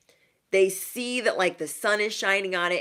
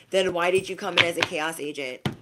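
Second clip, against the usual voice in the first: ordinary talk, nothing marked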